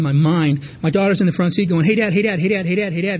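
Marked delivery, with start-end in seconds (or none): 1.8-3.2 lightly voiced child chanting hey Dad; a hint of a small girl pestering her father; little kid nagging. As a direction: daughter in the front seat, mild mock nagging chant. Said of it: "in the way that little children can do".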